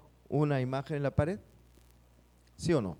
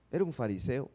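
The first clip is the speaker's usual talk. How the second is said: ordinary delivery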